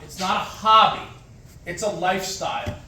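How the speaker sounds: usual speaking voice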